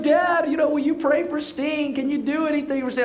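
high, pleading voice of a distraught son; mock a freaked-out kid begging dad; upset child. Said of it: "he is freaking out, and he is crying".

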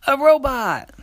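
high, loud, quick delighted cry; little sister voice. Acted. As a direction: squeal it like a little girl. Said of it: "the little sister said".

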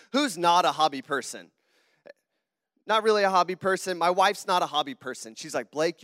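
ordinary delivery

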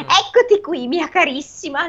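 high, sugary doting voice; fond father voice. Acted. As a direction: greet her like a doting dad. Father voiced greeting daughter. (padre affettuoso, voce alta e sdolcinata)

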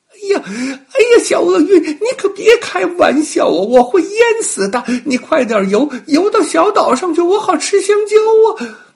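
very high, loud panicked monkey cries, half laughing; frightened squealing. Acted. Monkey sinking in the river begs the crocodile, high panicked cries. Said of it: "吓得小猴子哇啦哇啦乱叫".

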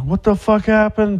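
high, panicked mock shriek; retold frantic scream. Heather waking him up in a panic, high frantic mock scream. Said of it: "she was kinda screaming frantically".

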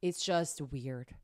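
low, slow, flat disgust; deadpan. Real, not acted. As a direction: drag it out with distaste. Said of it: "deadpan emphasis".

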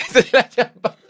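words squeezed out through laughter; laughing. Real, not acted. Still cracking up at her friend, laughing words. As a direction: talk while still laughing.

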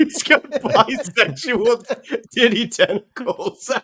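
laughing slowly. Real, not acted. Laughing slow description.

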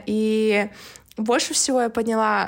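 ordinary delivery

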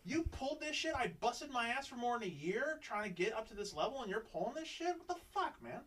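high-pitched mock outrage, retold; angry rant. Angry retold inner rant at boss.

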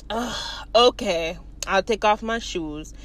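low, sighing compliance; grudging. Retold or imitated. Man agrees to remove shoes, grudging. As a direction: give in with a low groaned ugh.

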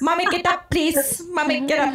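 put-on high child voice nagging mommy; pleading little boy. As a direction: pester mom like a small child. Imitates child waking his mother.

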